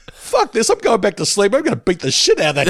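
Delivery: high, indignant outburst easing down; re-enacted angry resolve from a dream; comic fired-up vow; mock pissed off. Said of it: "really pissed off".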